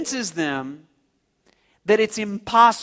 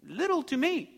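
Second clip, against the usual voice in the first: condescending, rising to a high coo; teasing high voice on the smallness; mocking God; 4 semitones higher than usual. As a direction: belittle it with mock tenderness. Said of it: "see God mocking them".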